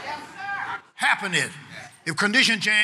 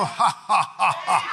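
spaced, punched-out "ha ha ha"; deliberate laugh; slower than usual. Shown as a demonstration. Preacher urging the church to laugh, deliberate laugh. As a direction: laugh it out in separate beats. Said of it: "Somebody help me laugh".

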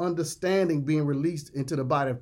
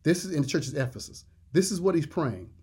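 normal talking voice

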